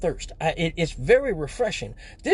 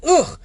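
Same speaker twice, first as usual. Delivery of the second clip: loud high groan of disgust; disgusted ugh; 9 semitones higher, 7 dB louder than usual. Retold or imitated. Comic tasting the green Hennessy, loud disgusted ugh. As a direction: retch at the taste.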